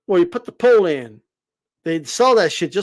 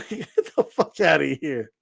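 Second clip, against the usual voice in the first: normal talking voice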